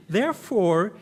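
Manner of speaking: ordinary delivery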